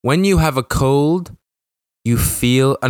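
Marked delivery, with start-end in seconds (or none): none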